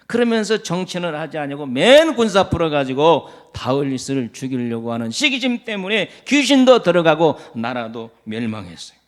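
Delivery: ordinary talk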